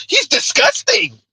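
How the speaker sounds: high-pitched, drawn-out mocking quote; exaggerated falsetto-like disgust. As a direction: mimic an outraged critic, high and slow. Mocking critics' reaction to the man.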